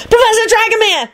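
very high, frantic call; frantic high-pitched cry; panicked scream. A narrator's reading. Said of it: "she screamed".

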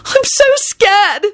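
slow, very high-pitched wail; bawling. A narrator's reading. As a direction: bawl it like a scared child. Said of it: "she bawled".

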